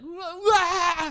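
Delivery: high squealing wail; mock whinny cry. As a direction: wail like a jittery horse. Imitates jittery horse.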